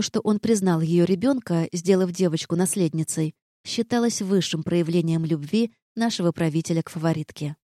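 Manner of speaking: ordinary delivery, nothing marked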